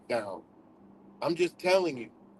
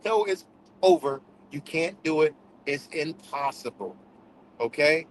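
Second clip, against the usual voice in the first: normal talking voice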